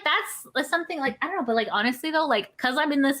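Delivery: normal talking voice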